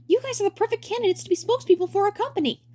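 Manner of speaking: bright high showman voice; high cheery director voice. Acted. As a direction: play the grinning director, way up high. Director character voice.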